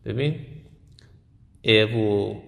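normal talking voice

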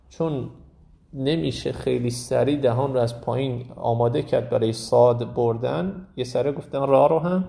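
ordinary delivery

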